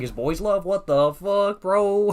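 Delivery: high, loud, incredulous outburst; retold shock. Streamer tells viewers his reaction to the premise, loudly incredulous. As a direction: act out being loudly appalled.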